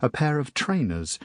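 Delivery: ordinary delivery, nothing marked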